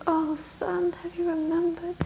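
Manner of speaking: lightly voiced eager mother; mother's voice. Mother asks son eagerly.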